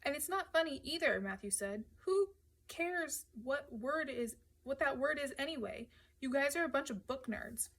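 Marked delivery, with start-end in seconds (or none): 0.0-1.2 higher-pitched irritation; annoyed retort. A narrator's reading. Matthew annoyed by the teasing. 2.0-7.7 higher-pitched, exasperated scorn; scornful. A narrator's reading. Matthew scorns the others' word talk.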